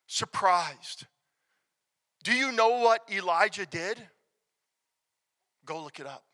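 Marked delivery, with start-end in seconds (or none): 5.6-6.2 low, soft throwaway challenge; quiet aside. Real, not acted. Preacher sends congregation to Scripture, quiet and low. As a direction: drop the voice right down. Same challenge repeated, building in force.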